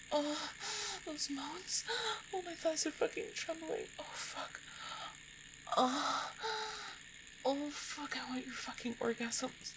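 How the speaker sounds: high, moaning intimate voice; aroused moaning. Acted. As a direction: let moans break into the words. Intimate performance, arousal building.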